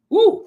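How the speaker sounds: high, loud "woo"; excited whoop. Real, not acted. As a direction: let out a high-pitched whoop. Excited countdown of albums.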